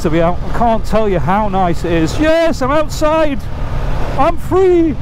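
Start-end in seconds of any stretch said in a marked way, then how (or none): 2.2-5.0 high, slow, drawn-out cry of freedom; joyful outburst. Real, not acted. Rider out after lockdown, calling out: high, drawn-out joyful cry. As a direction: sing out the relief of being outside at last. Joy at getting outside on bike.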